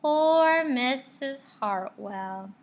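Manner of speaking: slow, drawn-out sad sigh; said sadly. A narrator's reading. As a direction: pity her, slowly. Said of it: "they all said sadly".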